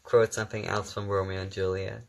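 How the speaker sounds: ordinary talk, nothing marked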